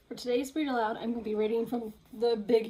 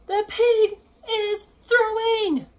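higher, louder, slow proclamation; triumphant shout; 11 semitones higher, 10 dB louder, much slower than usual. Acted. Piggy hurling the ball, shouting triumphantly. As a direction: announce it like a stadium crowd.

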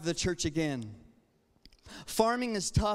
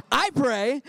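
bold volunteer; 5 semitones higher, 7 dB louder than usual. Retold or imitated. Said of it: "And I said".

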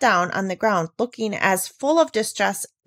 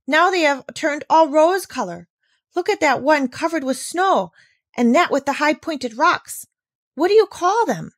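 high, bright eager questions; excited; 7 semitones higher, 4 dB louder than usual. Acted. Girl asking Peter about the rosy peaks, excited. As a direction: keep the wonder going.

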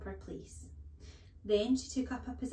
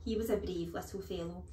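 plain reading voice

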